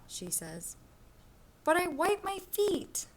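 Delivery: lively storybook read-aloud for a class; reading to children. Acted. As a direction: read it like a picture book to kids. Teacher reads picture book aloud.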